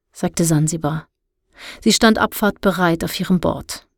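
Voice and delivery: usual speaking voice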